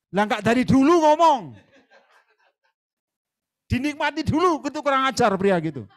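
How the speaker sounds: loud, high laughing jab. Real, not acted. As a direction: laugh it out at him. Mocking the man, laughing.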